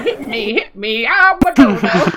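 dodo voice. Pretend. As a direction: play a goofy little dodo. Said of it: "The dodo has the same voice".

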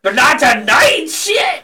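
slow, rising mock shout; loud exasperated imitation; retold protest. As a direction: stretch it out and pitch the last word high. Acted protest to screaming mother.

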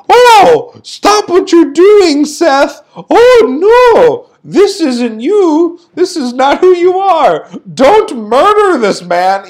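high, loud, hammed-up pleading; mock-pleading referee. Referee begging a wrestler to stop, mock-pleading. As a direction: plead in slow, overblown dismay. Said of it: "the referee goes".

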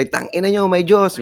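high mock yell from the crowd; mock shouted heckle. Fan heckling at a gig, mock high yell. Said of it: "sisigaw sila".

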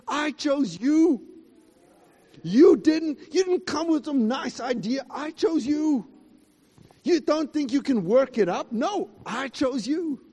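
God's declaration. Acted. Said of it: "because God said".

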